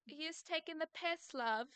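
slow, posh British put-on; mock British accent. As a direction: tease in a fake English voice. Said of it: "you can't sound badass saying stuff like that".

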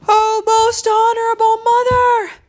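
put-on high, loud breathless child's cry; panting. A narrator's reading. Chang repeats to mother, panted cry. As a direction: cry it out at the top of your breath. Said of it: "he panted".

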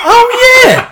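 high, loud mock cry of recognition; sudden realisation. Mates to narrator on learning who it was, loud realisation. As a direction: shout it as the penny drops.

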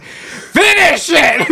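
growling Green Goblin. Retold or imitated. Green Goblin growling at Aunt May to finish her prayer. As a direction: drag out the command like a cackling villain. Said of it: "he's like".

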